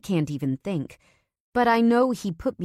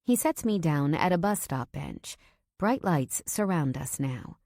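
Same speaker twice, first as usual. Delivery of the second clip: ordinary delivery, nothing marked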